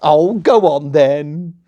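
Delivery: slow, loud playful yes; coy acceptance. Acted. Princess accepting the prince's proposal, slow and coy. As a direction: give in sweetly.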